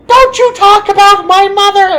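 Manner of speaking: very high, very loud bird screech; full-force squawked outburst. Retold or imitated. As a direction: shriek it like an outraged bird. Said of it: "mimic bird noises, and he had one just like".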